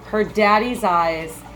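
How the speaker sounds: ordinary delivery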